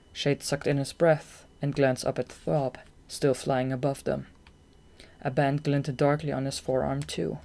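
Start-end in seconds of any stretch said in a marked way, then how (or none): none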